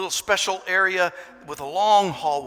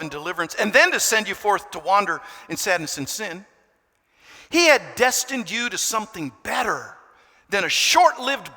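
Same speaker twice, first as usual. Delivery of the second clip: earnest, building reading; a louder preacher's reading; reading aloud; 3 semitones higher, 5 dB louder than usual. Acted. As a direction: read it out with rising fervour. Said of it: "let me read it to you".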